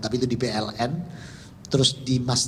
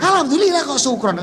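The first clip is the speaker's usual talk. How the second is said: much higher and louder, laughing disbelief; amused correction; 6 dB louder than usual. Retold or imitated. Son correcting his father's word, high and amused. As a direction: playfully scold his choice of word.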